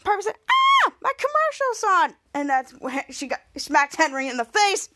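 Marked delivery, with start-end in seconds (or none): 0.5-2.1 high, loud, drawn-out delight; mock excited squeal. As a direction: act out a thrilled squeal. Acted thrill at seeing commercial.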